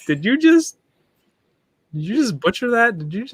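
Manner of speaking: high, incredulous mocking voice; mock disbelief. Debate rival mocking a candidate's Spanish, high incredulous mock. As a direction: act out a rival scoffing at butchered Spanish.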